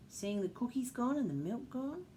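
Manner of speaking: usual speaking voice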